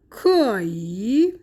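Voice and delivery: slow "کایی", first syllable in second tone, last dipping then rising; drawn-out sandhi demo of "کایی"; tone two plus three. Shown as a demonstration. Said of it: "به این حالت".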